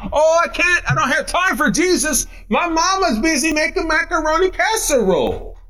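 high, whiny churchgoer's excuse; put-on high voice of someone begging off; mock excuse-maker. Mimics a churchgoer's excuse for skipping.

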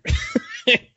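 high burst of laughter; real amused laugh. Real, not acted. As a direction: laugh at your own remark. Amused at own monster line.

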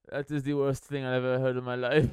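robotic, matter-of-fact delivery; Werner Herzog. Retold or imitated. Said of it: "very robotic way of saying that".

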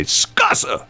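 high-pitched shout of a fed-up spouse; heated retort. Retold or imitated. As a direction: throw it out as the last word in a fight. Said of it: "the fights would always end when one of them would say".

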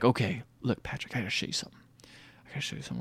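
hushed, near-whispered mock secret; secretive confiding. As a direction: let a friend in on it, very softly. Boy sharing a secret.

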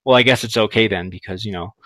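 low, trailing-off imitation; reluctant concession. She concedes to him about sex, low and trailing off. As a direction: concede it softly, letting it fade away. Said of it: "like that".

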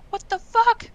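high, loud surprised yell; a rogue shouting her surprise at a goblin. Acted. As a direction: bark it out in shock. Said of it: "I'd be, like, shouting it".

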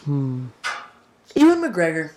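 ordinary talk, nothing marked

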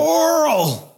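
high shouted name; frantic mother calling out; calling for Carl. Retold or imitated. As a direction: yell for the child. Calling out for her son.

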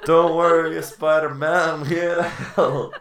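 high, loud heroic announcement; mock hero. Would-be helper calls to Spider-Man, loud mock-heroic cry. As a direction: proclaim the rescue, stretching the last words. Acts own heroic cameo.

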